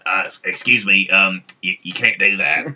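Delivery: whiny nasal nerd voice; fussy stickler in a nasal whine; nasally voice. Retold or imitated. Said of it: "he'd be like".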